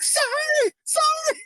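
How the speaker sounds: very high, squeaky mock apology; scared woman. Answering the doctor's order to open the door, mock scared. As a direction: imitate her terrified whimper, way up high. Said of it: "she's so scared".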